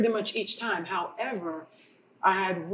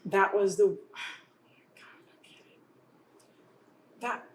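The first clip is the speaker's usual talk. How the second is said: halting, with long pauses and a sigh; real choked-up emotion. Real, not acted. Woman recalling her stillbirth to other mothers, choked up. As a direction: stop and start, struggling to go on. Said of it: "I don't even get emotional like this".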